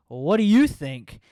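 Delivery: loud, slow, pointed "what do you think"; deadpan sassy retort. Retold or imitated. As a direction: hit it loud and slow, palm out at her partner.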